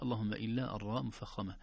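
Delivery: normal talking voice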